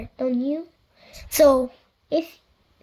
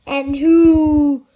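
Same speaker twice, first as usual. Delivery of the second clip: loud, slow giant voice; booming giant; 2 semitones higher, 11 dB louder than usual. A narrator's reading. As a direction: boom it out like a giant. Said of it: "he bellowed".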